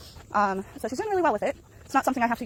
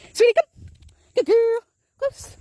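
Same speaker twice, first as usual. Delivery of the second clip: much higher, louder praise for the dog; 13 semitones higher, 7 dB louder, much slower than usual. Real, not acted. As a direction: cheer the dog on, sing-song high. Calling and praising her dog.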